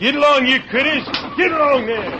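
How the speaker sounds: high, full-voiced yell to the team; loud wagon driver's call; driver's shout. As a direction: holler the animals onward.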